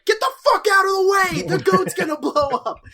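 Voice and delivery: loud, high acted warning; mock panicked shout. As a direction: yell like an alarmed crowd, half laughing. Acting townspeople shouting a warning.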